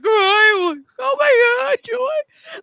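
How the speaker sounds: high, loud retold shock; reliving being floored; awed cry of disbelief; stunned gasp. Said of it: "we're, like, laying there, like".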